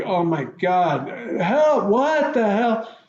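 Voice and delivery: high, slow, panicked disbelief; stunned and drawn out, pitched high; shocked disbelief. Retold or imitated. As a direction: cry out in horror at the news. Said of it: "I was like".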